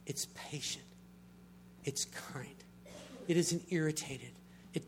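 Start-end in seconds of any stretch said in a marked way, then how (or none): 0.1-2.5 soft whisper. Acted. Reading love's traits softly.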